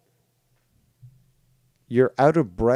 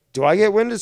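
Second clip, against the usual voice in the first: ordinary talk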